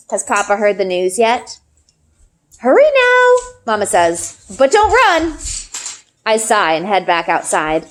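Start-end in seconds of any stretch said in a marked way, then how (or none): 2.6-3.3 high, brisk mother's call; a hint of a mother urging; Mama's voice. A narrator's reading. Mama voiced by narrator. 4.6-5.4 high, brisk mother's call; a hint of a mother urging; Mama's voice. A narrator's reading. Mama voiced by narrator.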